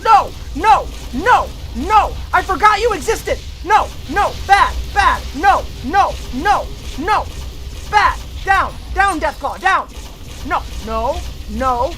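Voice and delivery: yelling no like at a bad dog; shouted commands at an attacking beast; loud scolding. Real, not acted.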